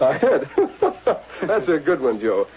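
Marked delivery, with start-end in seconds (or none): 0.5-1.4 scoffing laugh. Acted. Scoffing at the idea of a hit.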